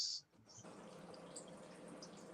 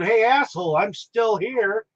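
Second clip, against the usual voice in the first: mock yell; 8 semitones higher than usual. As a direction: call it up to the gunman, not at full force. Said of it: "he yells up".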